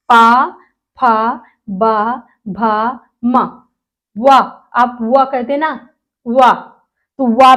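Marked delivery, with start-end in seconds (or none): 0.1-3.6 teacher repeating the labial row; lip syllables. Shown as a demonstration. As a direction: press the lips together for each syllable. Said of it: "हम बोल के देखते हैं".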